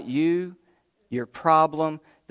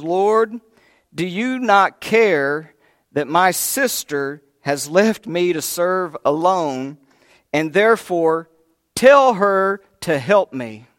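demanding; 3 semitones higher, 7 dB louder, slower than usual. Retold or imitated. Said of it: "she's actually demanding".

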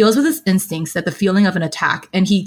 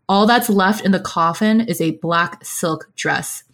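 ordinary delivery, nothing marked

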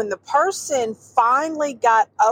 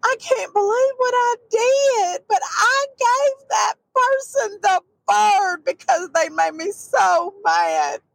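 grandmother crying; 7 semitones higher than usual. Retold or imitated. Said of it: "she said".

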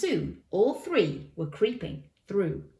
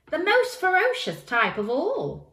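put-on high, loud child voice; declaring boy; 10 semitones higher, 5 dB louder than usual. A narrator's reading. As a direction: announce it as a dramatic warning. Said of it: "declared the boy".

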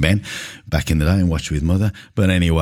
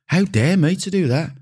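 mock outrage; 13 semitones higher than usual. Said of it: "mock self-outrage".

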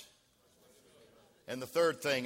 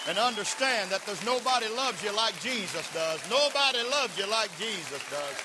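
louder, raised preaching voice; rallying preacher; 5 semitones higher, 4 dB louder than usual. Acted. Pastor urging the congregation to praise, raised voice. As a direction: stir the church up.

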